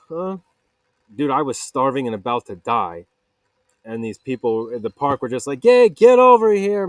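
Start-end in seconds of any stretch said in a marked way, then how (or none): 5.6-6.9 slow, loud, high holler; calling out. Retold or imitated. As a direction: wave him over with a big shout. Park people calling him over.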